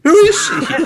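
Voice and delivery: very high-pitched incredulous squawk; utterly baffled, voice leaping high; exasperated bafflement. Real, not acted. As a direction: demand it in a squeaky, baffled outburst. Baffled outburst about unexplained character.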